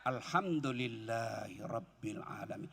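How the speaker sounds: low, slow, soft; slow heartfelt praise. Shown as a demonstration. Preacher showing grateful dhikr, slow and soft. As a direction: savour it with an open heart. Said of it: "ucapkan dengan lapang dada".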